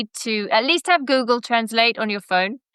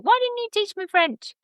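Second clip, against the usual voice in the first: much higher, a mock little girl's complaint; imitating young daughter; 11 semitones higher than usual. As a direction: mimic her small daughter whining. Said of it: "she's like".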